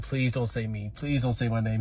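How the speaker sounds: low, soft, under-the-breath pleading; anxious hushed plea. Retold or imitated. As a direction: plead quietly, hoping not to be named. Silent plea as teacher asks.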